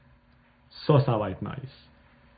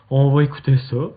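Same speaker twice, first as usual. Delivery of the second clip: louder, rising on va; 3 semitones higher, 7 dB louder than usual. Shown as a demonstration. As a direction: call it out louder.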